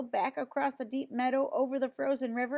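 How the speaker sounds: plain reading voice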